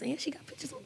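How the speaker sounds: a quick unvoiced whispered aside. Real, not acted. Cohost whispers a sly aside about the wedding photos, whispered. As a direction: drop to a secret whisper. Aside remark during wife story.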